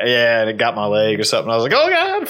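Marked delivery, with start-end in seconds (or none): 1.8-2.3 freaking out. Retold or imitated. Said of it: "I remember just freaking out".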